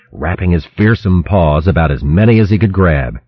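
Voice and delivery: plain reading voice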